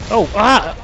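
sudden high yelp; startled yelp. Real, not acted. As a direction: caught off guard, cry out. Startled during a firefight.

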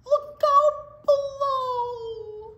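high, loud, long-drawn call; falling warning yell. A narrator's reading. Falling animal warns those below, long high yell. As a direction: holler down, stretching the words out.

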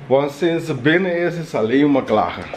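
usual speaking voice

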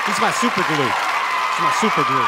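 loud high ring announcer intro; hype announcer. Acted. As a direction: announce him like a prizefighter.

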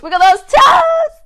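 high, loud cheerleading for a wiggle frenzy; loud, high-pitched workout hype; excited rallying. Real, not acted. Rallying kids to wiggle everything, countdown.

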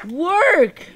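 loud, high command; frustrated yell. Real, not acted. Gamer ordering the frozen game to respond, frustrated yell. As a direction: yell it at a broken machine.

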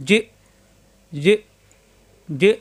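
"জি" short, cut by a glottal stop; "জি" stopped abruptly; caught in throat. Shown as a demonstration. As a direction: say "জি" without stretching the vowel. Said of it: "গলার ভিতরে আটকে যাবে কিন্তু".

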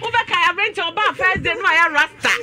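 high, loud burst of delight; overjoyed. Real, not acted. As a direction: shout for joy at the good news. Joyful outburst at good news.

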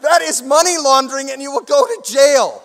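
loud, high, emphatic warning; stern lawyer warning. Retold or imitated. Lawyer warns them of jail in a loud high voice. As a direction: lay down the law, loudly.

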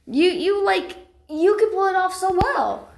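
high, eager encouragement. Acted. House cat urging Alex to try the forest, eager encouragement. Cheering on a friend.

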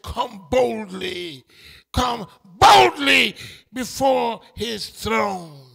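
slow, building to a loud call; God's invitation. Acted. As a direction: stretch out boldly, then shout it. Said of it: "God said".

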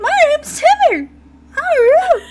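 very high, loud gingerbread-man squeak; Timmy. Acted. Podcaster introducing spirit child, high squeaky voice. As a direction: squeal it like an excitable cartoon cookie. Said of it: "Timmy sounds like the gingerbread man from Shrek".

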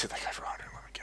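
awed whisper. Real, not acted. Player repeats his huge hit to viewers, awed fast whisper.

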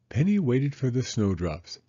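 usual speaking voice

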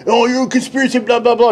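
high, whining mock voice with a shout; mimicking a friend as a whiny crybaby, high and mocking; mocking whiny quote. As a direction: whine it back at him like a crybaby. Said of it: "Cruz was like".

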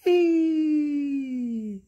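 high, long gleeful cry; a bird soaring at last; joyful whee. Acted. Bernard joyfully flying at last.

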